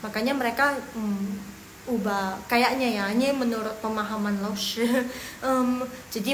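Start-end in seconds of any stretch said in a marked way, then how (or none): none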